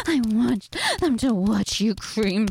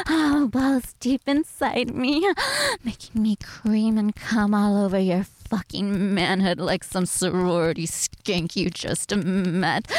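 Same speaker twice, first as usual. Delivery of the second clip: normal talking voice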